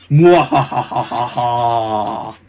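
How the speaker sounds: loud, drawn-out villain cackle; booming slow mwahaha; evil laugh. Acted. Ghost leaving in triumph, evil laugh.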